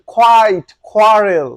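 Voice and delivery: "কোয়াইট" and "কোয়ারেল" with aspirated kw; loud, separated model words with puffed k; correct pronunciation. Shown as a demonstration. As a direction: punch "কোয়াইট", "কোয়ারেল" loudly. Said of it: "এটা হচ্ছে".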